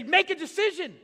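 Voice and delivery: high, loud yell at the squirrel; exasperated shout. Retold or imitated. Pastor yelling at squirrels in the street, loud and exasperated. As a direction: shout it at the dithering squirrel, half laughing.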